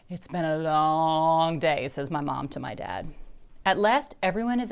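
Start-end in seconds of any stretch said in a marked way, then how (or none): 0.1-1.9 tired mom. A narrator's reading.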